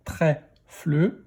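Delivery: "Trèfle" split into trè, fle; "Trèfle" in two beats; syllable by syllable. Shown as a demonstration. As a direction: sound out "Trèfle" with a separate fle. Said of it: "Première syllabe".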